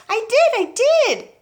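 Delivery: high, loud, slow protest; insistent shout. Acted. George protests up to Grandma, high and slow shouting. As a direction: plead it up at her. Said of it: "George shouted".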